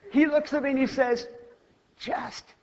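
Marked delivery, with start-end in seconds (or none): 2.0-2.6 squeezed out, much softer; strained hush. Retold or imitated. Crushed boy to the girl lying on him, strained and hushed. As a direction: barely get the words out, as if crushed.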